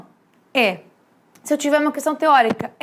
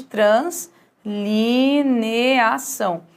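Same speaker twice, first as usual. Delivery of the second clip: very slow, drawn-out "translineação"; syllable by syllable; 2 semitones lower, much slower than usual. Shown as a demonstration. Teacher writes out the hyphenated word for students, syllable by syllable. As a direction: stretch each syllable of "translineação", as if writing it.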